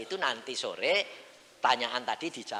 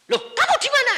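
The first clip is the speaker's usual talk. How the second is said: high, loud startled question; wife on the phone, startled and loud; surprised call; 15 dB louder, faster than usual. Retold or imitated. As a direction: jump up in pitch, startled. Wife searching anxiously on phone.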